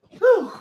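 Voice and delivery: high, short puff of breath; winded whew. Real, not acted. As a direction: blow out a relieved, winded whew. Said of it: "I'm out of breath".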